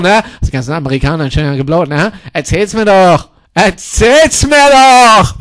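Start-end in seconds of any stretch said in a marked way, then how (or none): 3.5-5.3 slow, stretched mocking singsong; milk every syllable of "mir"; drawn-out taunt. Taunting the old woman, drawn out slow.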